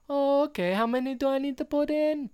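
high, exaggerated fake French accent; hammed-up French accent in a high voice; [name]. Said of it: "he's doing a very fake French accent".

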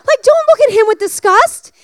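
high, loud, pleading he is blind; half-shouting in disbelief at the crowd; exasperated outburst. Retold or imitated. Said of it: "I'm like".

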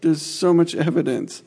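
put-on replying to a drunk woman about climate change, slow and insistent; insistent retort. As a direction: spell it out slowly as if it's obvious. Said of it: "I was like".

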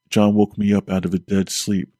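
ordinary delivery, nothing marked

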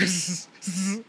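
wheezy hiss laugh. Retold or imitated. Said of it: "He be like".

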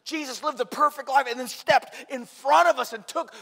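loud, high, ringing sermon voice; full-force preaching. Acted. Pastor preaching to the congregation about God, loud and ringing. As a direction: proclaim it to the whole church.

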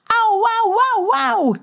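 high, wailing cries of pain; pirate yelping over his bitten fingers; whimpering ows. Acted. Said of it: "kermt hij". (hoog jammerend gekerm van pijn)

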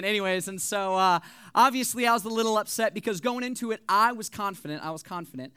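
usual speaking voice

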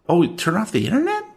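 high chirpy desk clerk; Tracy voice. Acted. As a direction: pitch way up for the clerk.

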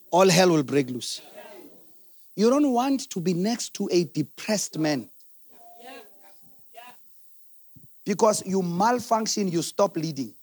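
soft, low, intimate sermon voice; hushed preaching. Acted. Pastor warning wives about a depressed man, hushed and low. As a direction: drop right down and draw them in. Quiet reflective preaching stretch.

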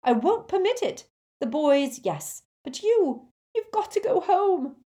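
high, loud motherly shouting; angry mum shouting at her daughter. A narrator's reading. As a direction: forbid it at the top of your voice. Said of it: "Missus Weasley shouted".

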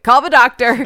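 imitated panicked sister; mock alarm. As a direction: call out in exaggerated alarm.

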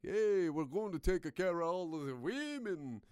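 loud fake Middle Eastern accent announcement; mock Taliban accent. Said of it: "I don't know what this accent is".

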